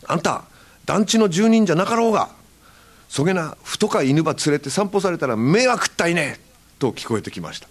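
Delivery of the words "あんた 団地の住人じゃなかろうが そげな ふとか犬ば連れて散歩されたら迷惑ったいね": Hakata dialect scolding. Retold or imitated. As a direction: tell off a stranger in Hakata dialect. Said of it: "おじさんの声で". (博多弁で叱りつける)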